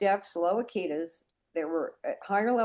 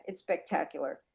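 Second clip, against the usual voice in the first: softer retake; 4 semitones lower, 8 dB quieter than usual. Shown as a demonstration. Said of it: "let me say that again".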